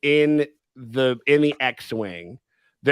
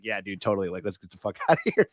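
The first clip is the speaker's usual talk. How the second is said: lower, softer laid-back sidekick voice; imitating R2; 7 semitones lower, 3 dB quieter, faster than usual. Podcaster plays R2 agreeing to escape, casual. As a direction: agree coolly, low and quiet. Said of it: "And R two's like".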